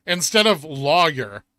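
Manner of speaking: lawyer said slowly, law then yer, loud; careful lawyer. Shown as a demonstration. Correcting chat on lawyer, slow and loud. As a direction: spell out lawyer, slow and firm. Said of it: "is they say lawyer".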